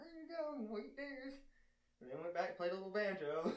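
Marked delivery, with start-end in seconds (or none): 0.0-1.4 waitress voice. Retold or imitated. Voicing the smoking waitress.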